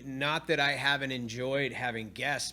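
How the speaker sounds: normal talking voice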